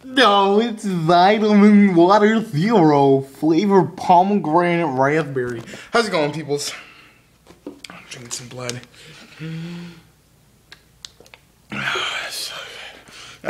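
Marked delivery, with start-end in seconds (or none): 0.0-5.6 mock ad voice. Mock ad read of drink. 7.7-13.5 mock creepy hush. Joking vampire line, whisper.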